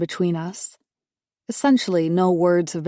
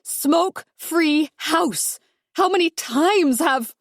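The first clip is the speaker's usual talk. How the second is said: high, slow, scolding yell; wife scolding mother-in-law about smoking; 9 semitones higher, slower than usual. Acted. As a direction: lay down the house rule. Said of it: "I yell".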